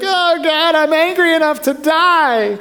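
high, drawn-out angry wail; hammed-up melodramatic complaint to God; whiny Jonah outburst. Jonah under the withered bush, hammed-up high wail. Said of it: "I think that's how he said it".

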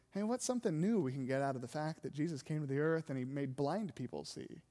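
low, hushed, unimpressed question; jaded indifference. Acted. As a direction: ask it low and soft, as old news. Acting out jaded response to familiar story.

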